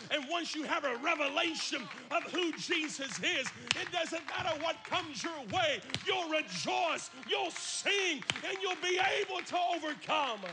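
preaching building up to a loud shout. Acted. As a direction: climb from firm to full-force shout.

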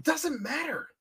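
hushed but heated, strained whisper; whisper yelling. Real, not acted. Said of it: "He's whisper yelling".

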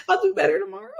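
laughing. Real, not acted. Laughing at her own parenting.

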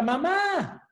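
put-on a child's call for mom, pitched high; niño grita. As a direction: call out for mom like a startled kid. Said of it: "el niño grita". (niño que llama a su mamá con un grito agudo)

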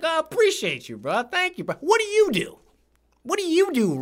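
high-pitched, hammed-up thanks and question; gushing reply, imitated. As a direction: flip the question back in a squeaky-high voice. Replaying own high-pitched reply to Rich.

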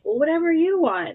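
high, loud, shrugging whatever; mock careless family reply. Family dodging the dinner question, mock careless. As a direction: toss it off like nobody cares. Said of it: "They're like".

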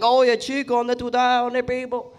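high, loud mock Cuban accent; strong Cuban accent. Comic showing how Cubans talk, strong Cuban accent. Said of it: "they have a strong accent".

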